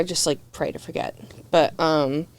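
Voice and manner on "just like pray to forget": whispered aside. Real, not acted. Confessing quietly.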